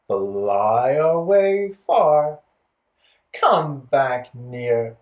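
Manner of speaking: finger rhyme contrasting near and far; near and far voices. Shown as a demonstration. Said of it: "let's do a different version".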